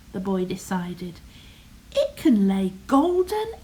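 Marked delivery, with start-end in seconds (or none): 1.9-3.5 excited. Acted. Boy excited about hen.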